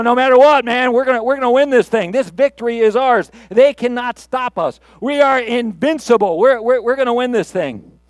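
coach's pep talk. Acted. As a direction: fire up the team, sure of victory. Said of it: "the coach standing up and saying".

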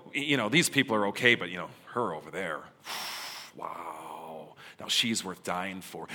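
lightly acted, sizing people up with a sigh; a hint of a snooty chooser weighing people; mock appraisal. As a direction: play a picky judge, dismissive then impressed. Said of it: "he looks at you and go".

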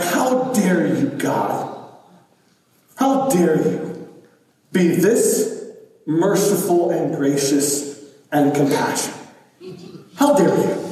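imitating a sulking child's outrage; angry sulk. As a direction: Jonah pouts at God, mock angry. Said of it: "angry, upset".

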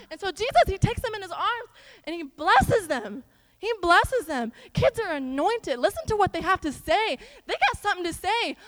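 loud, high, fervent sermon delivery; impassioned preaching. Real, not acted. Preaches at full force.

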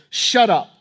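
loud, curt order to silence; firm, acted rebuke; forceful command. Preacher voices Jesus ordering the storm.

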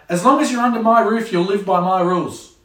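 a strict parent laying down the law at home. Acted. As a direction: lay down the house rules. Parent laying down house rules.

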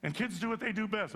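normal talking voice

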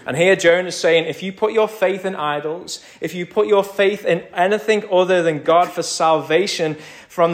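high, loud urgent warning to the congregation; preaching at full force. Acted. Preacher warns the congregation, loud and urgent. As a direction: press the warning home, building.

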